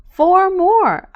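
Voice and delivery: high, loud eager call; excited shout. A narrator's reading. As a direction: shout it gleefully. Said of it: "I say".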